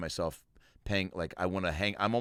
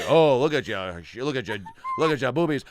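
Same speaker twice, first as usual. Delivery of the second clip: loud, higher cartoonish ogling; leering date; 4 semitones higher, 6 dB louder than usual. Guy ogling his date, hammed-up cartoon leer. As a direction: ham up the drooling admirer. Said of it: "if any guy was on a date and he was like".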